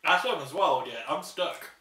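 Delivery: talking while still chewing; covering mouth with hand; mouth full. Real, not acted. Seen 0: Left speaker talks with hand at mouth, clearly still chewing; speaker unsure.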